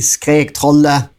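ordinary delivery

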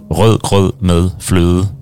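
native Danish tongue-twister dessert name; Danish pronunciation. Shown as a demonstration. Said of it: "test people's pronunciation in Danish".

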